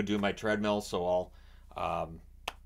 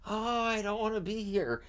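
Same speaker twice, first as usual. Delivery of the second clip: much higher, hammed-up whine; whiny mock complaint; 11 semitones higher than usual. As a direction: whine like a bored reality star begging to leave. Mocking bored Kate's whine.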